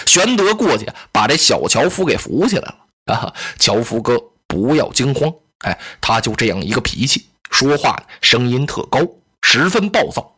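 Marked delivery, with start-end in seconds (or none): 3.1-10.3 lower, gentle soothing voice; Liu Bei reassuring. Acted. Liu Bei comforting the scared woodcutter, lower and gentle.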